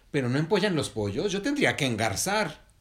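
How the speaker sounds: high, loud heron objection; loud protest. Acted. Heron objects to the word empollar, protesting.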